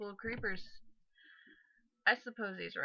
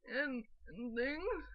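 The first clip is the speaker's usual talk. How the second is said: higher, drawn-out imitation of a character voice; Dodger; 6 semitones higher, 5 dB louder, much slower than usual. Recalling a loved voice for Donald, an imitation. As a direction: imitate a slow, swooping voice. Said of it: "Dodger's voice for Donald".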